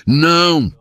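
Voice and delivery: sudden high, loud "Não!"; a child screaming no at the school gate; shrill refusal of a hurt boy; boy's shout. Acted. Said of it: "Gabriel gritou".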